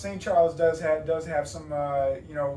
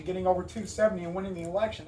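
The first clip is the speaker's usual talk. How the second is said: normal talking voice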